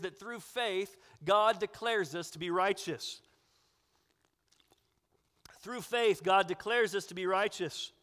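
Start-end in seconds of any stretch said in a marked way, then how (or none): none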